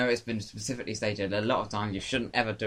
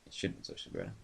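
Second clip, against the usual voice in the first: whisper; 15 dB quieter, faster than usual. Acted. Ominous whisper echo.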